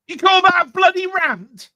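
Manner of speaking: loud, high incredulous jab; loud teasing disbelief at the weak rant; mock outrage. Real, not acted. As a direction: scoff loudly at a feeble rant.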